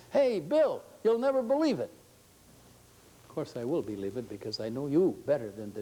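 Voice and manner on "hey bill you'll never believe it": much higher, a bit louder, bursting with news; excited treasurer. Retold or imitated. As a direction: play the treasurer who can barely contain himself. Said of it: "And he says".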